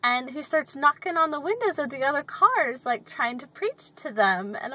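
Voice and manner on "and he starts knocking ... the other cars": ordinary talk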